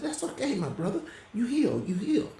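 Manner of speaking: bright soothing encouragement to a brother; high reassurance. Retold or imitated. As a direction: tell him he's healed with a lifted, joyful voice. Reassuring the sick friend in dream.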